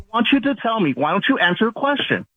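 high-pitched, agitated demand; emotional, insistent challenge; worked up. Real, not acted. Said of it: "you're so emotional".

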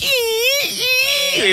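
high squealing balloon noise; loud squeal. Retold or imitated. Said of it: "It was a little loud".

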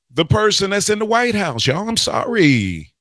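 whining. Real, not acted. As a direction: let it slide up into a whine. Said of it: "Am I whining on the air?".

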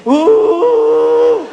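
high, loud, squealing ooh; mock a woman's pleasured squeal. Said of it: "once you make a woman say".

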